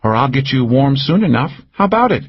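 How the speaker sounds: coy neighbour inviting him; Cal's voice. A narrator's reading. Narrator voices Cal.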